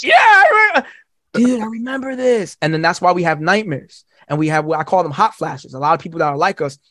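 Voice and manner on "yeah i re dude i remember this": excited shout. Retold or imitated. Remembering smashing skulls together, high excited shout. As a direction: blurt it out thrilled.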